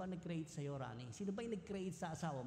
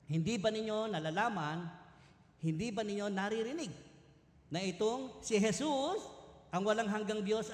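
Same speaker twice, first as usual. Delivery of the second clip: louder, higher scripture reading; louder preaching read, slowing on "na itong si Jesus"; proclaiming scripture; 3 semitones higher, 4 dB louder than usual. Acted. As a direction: proclaim the verse to the congregation. Reading scripture with rising force.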